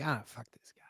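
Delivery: breathy hushed whisper under the breath; whispered aside. Retold or imitated. Retelling her reaction to the documentary, whispered. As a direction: whisper it like a private curse.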